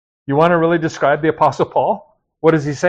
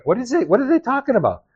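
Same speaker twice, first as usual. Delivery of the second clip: puzzled bystander; 8 semitones higher, faster than usual. Retold or imitated. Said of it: "if you go around talking like that, people are gonna".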